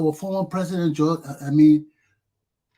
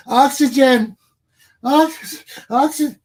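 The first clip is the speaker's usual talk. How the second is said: loud, high pretend gasp for oxygen; mock cry for air; 7 semitones higher, 7 dB louder, slower than usual. Pretending to suffocate over the news, crying out. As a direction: cry out like you are choking.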